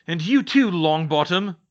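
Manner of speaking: loud, high, spat-out spite; chained Crouch spits venom at Neville, loud and spiteful; spiteful hiss. Acted. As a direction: spit the name at him. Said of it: "Crouch hissed and spat at him".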